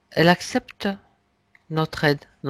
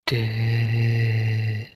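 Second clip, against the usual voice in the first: hypnotic induction; 3 semitones lower, slower than usual. Acted. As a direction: lull them down into deep relaxation.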